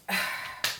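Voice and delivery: long, soft, voiceless exhale; exasperated sigh. Real, not acted. Recapper fed up with Leah's jealousy, sighing. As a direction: let out a weary breath.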